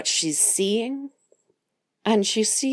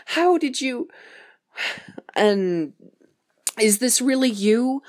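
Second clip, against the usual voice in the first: higher-pitched, mock-distraught disbelief; tearful cursing out; 6 semitones higher than usual. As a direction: curse him out in high, halting disbelief. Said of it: "she's kind of cursing him out".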